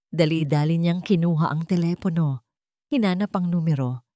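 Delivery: ordinary delivery, nothing marked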